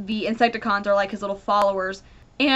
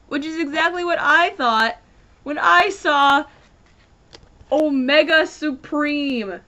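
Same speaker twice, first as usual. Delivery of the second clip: excited disbelief; 5 semitones higher than usual. Real, not acted. Excited recall of surprise.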